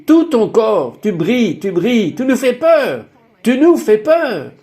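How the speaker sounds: high-pitched imitation of frightened relatives; alarmed exclaiming. Family tells the glowing speaker they are scared, alarmed exclaiming. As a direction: voice their alarm, pitch climbing on the fear.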